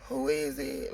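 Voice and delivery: low groggy just-woke-up voice; sleepy low voice. Shown as a demonstration. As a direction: answer the door half asleep. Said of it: "Like that".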